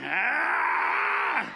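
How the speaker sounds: long, drawn-out mock scream; comic yell. Comic acting out the back-seat climax, long mock scream. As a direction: let out a sweeping hammed-up cry.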